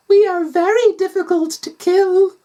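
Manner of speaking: very high, eerie creature voice; tiny high voice, not actually soft; creepy floof. A narrator's reading. Floof answering the doctor's threat, high and creepy. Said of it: "then softly".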